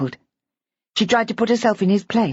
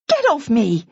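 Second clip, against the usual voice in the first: indignant; 5 semitones higher than usual. A narrator's reading. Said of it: "with a shake".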